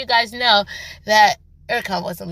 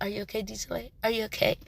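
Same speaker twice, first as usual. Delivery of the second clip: much softer, hushed worried check; tender concern; 11 dB quieter, much faster than usual. Retold or imitated. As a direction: gently ask as if to a hurt loved one. Voicing Erkan comforting Dijole.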